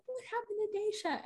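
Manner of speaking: high, soft imitated coworker voice; overheard coworker. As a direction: ask softly and high, as someone overheard.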